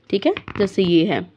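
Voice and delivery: ordinary talk, nothing marked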